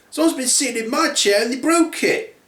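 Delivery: high-pitched put-out cub; Baby Bear. Acted. Little bear with a broken chair, upset. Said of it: "The Baby Bear then says".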